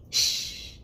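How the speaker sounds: a soft breathy shh; quick whispered shush to cut someone off. Real, not acted. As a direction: hush them, barely voiced. Shushing the bot mid-sentence.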